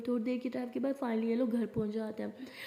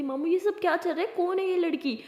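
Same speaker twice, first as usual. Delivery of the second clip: high, louder angry demand; 6 semitones higher, 4 dB louder than usual. Acted. Aliya angrily demands her uncle explain the girl. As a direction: demand an answer, furious. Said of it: "Alia को बहुत गुस्सा आता है".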